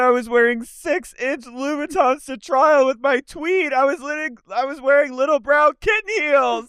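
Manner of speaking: high, put-on imitation of a pouty teen; mocking Alexis. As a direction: mimic her indignant high whine. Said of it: "She said".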